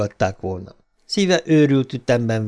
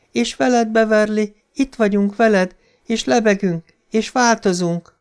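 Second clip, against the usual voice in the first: many dead voices calling her down; shifting ghost voices; 9 semitones higher than usual. A narrator's reading. Dead children from the drain beckon Beverly, high and eerie. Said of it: "hadarva beszélt, és közben folyton változott".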